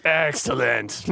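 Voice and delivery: slow, sinister approval; sinister villain. Acted. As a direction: savor the word like a villain.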